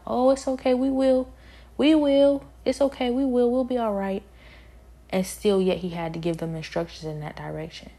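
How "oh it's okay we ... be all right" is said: high, loud, breezy brush-off; mock reassurance. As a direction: mimic careless people waving off a warning. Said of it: "And they kept saying".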